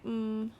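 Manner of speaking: short hum on one even note; brief steady "mm" hum. Shown as a demonstration. Teacher confirms the sound on the page, steady "mm". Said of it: "tell me the sounds again".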